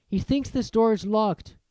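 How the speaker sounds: ordinary delivery, nothing marked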